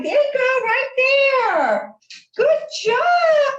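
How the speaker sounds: high, eager coaxing; excited dog praise. Real, not acted. Owner cheering Marty on as he hits targets, excited praise. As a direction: lift your voice to cheer on a pup.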